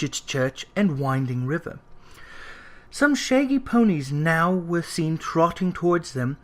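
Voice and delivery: ordinary delivery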